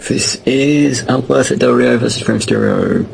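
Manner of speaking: ordinary talk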